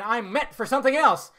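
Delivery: put-on young Luke. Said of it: "it's just a kid going".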